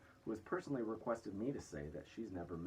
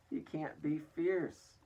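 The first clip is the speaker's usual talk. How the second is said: slow, loud, higher exclamation; exclaimed; 8 semitones higher, 6 dB louder, much slower than usual. A narrator's reading. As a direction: draw it out in disbelief. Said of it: "exclaimed the head of all the cops".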